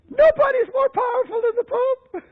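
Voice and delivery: high-pitched insistent boast; acted-out tirade; haranguing. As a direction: lay it down high and emphatic. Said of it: "This man in his harangue said".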